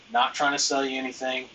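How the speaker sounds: ordinary delivery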